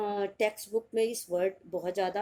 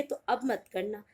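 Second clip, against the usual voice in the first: ordinary talk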